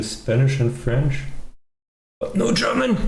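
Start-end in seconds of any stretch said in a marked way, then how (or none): 2.2-3.1 high, incredulous complaint on German; pitched way up in exasperated disbelief. Real, not acted. Annoyed the TTS lacks German.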